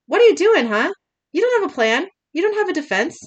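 high-pitched, pressing questions; indignant acted demand; acted challenge. A narrator's reading. Dean challenges Sam in a quarrel.

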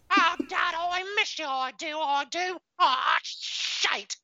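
very high Cockney kid voice, mock sobbing; hammed-up crying Cockney urchin; squealing Cockney orphan whimper; Oliver Twist Cockney. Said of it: "unless he was super Cockney, like Oliver Twist Cockney".

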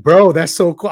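hyped-up loud admiration; excited. Retold or imitated. Excited buddy gushing at a friend about a scene. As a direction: gush about how cool it is.

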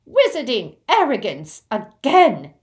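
loud, high goblin indignation; bristling anger. Acted. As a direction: burst out in disgust at an old insult. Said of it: "bristling with anger".

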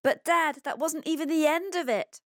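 put-on high, loud, breathless protest from a boy; worked-up child who can't stop now; out of breath. A narrator's reading. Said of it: "continues Pablo out of breath".

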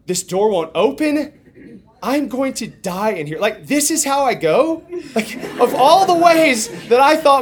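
high, rising panicked disbelief; mounting panic. Retold or imitated. Trapped man thinking he will die in the freezer, acted panic. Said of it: "the panic really starts to set in".

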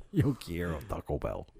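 quick Spanish line in a mock accent; Taco Bell chihuahua. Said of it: "Remember the fucking dog?".

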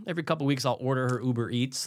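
normal talking voice

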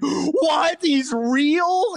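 high, stunned "what, he's real"; mock disbelief. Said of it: "and I'm like".

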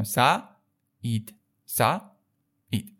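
سعید split slowly into sa, then id; vowels kept apart. Shown as a demonstration. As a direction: break سعید in two, a pause before the i. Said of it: "دیگه اینا رو من با هم دیگه ترکیب".

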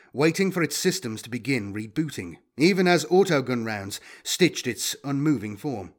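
usual speaking voice

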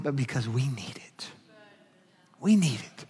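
whispered, soft punchline. Real, not acted. Pastor whispering the point to his church. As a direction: drop to a whisper to land it.

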